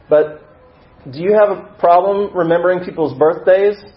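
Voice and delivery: loud, high, probing question; calling out. Acted. Newcomer asks the voice in the back, calling out. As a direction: call it across the room, testing them.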